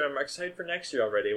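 usual speaking voice

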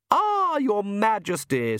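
high, drawn-out aristocratic greeting; Countess, gushing hostess. Acted. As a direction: greet him grandly, stretching the words. Countess greeting royal guest.

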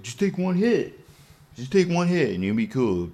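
high-pitched peer pressure; coaxing. Retold or imitated. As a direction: imitate a pushy friend coaxing. Acting friend pressuring him.

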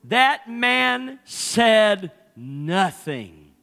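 each word dragged out, very slow and loud; slow indignant emphasis. Retold or imitated. Pastor retelling his mother-in-law's indignation, slow and loud. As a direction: lean hard on every word of That man said nothing. Wife fuming at silent husband.

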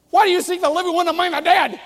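high, loud, fast tirade; hammed-up angry yelling; yelled scolding. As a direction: berate them at full volume. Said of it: "you don't have to yell".

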